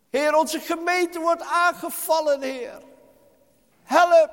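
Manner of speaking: loud, high pleading cry for help; crying out to God. Shown as a demonstration. Preacher shows the congregation's cry to God, loud pleading. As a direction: call out to the Lord, desperate and loud. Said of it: "Maar onze schreeuw moet zeggen".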